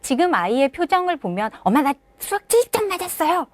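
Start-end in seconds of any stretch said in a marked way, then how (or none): 1.6-3.4 put-on high, quick proud child. Shown as a demonstration. Child proudly tells mom the math score, high and quick. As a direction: brag about the score like a pleased kid. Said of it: "자랑스러워하는 것도 아니고".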